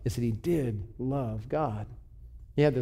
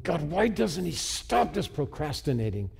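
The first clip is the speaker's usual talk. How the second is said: higher-pitched, frustrated venting to God; exasperated complaint; 4 semitones higher than usual. Retold or imitated. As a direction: act out fuming under your breath at heaven. Retold fuming complaint to God.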